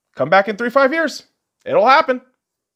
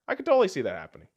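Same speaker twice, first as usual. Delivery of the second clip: quick throwaway repeat; 2 semitones higher, 6 dB quieter, much faster than usual. Real, not acted. Quick repeat of the same line.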